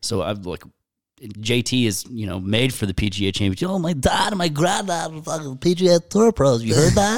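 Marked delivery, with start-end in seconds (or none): 3.7-7.2 high-pitched, mocking boastful voice; mock braggart. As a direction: put on an exaggerated brag, pitched way up. Mocking golfers who boast about family.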